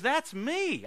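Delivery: high-pitched mock outcry, only mildly loud; retold scream. Preacher retells a healed man's outburst, mild mock scream. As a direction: imitate a man blurting it out. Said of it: "he screamed it out loud".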